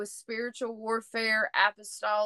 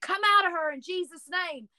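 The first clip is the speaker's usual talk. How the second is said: retold exorcism command; 7 semitones higher than usual. Retold command casting out a spirit.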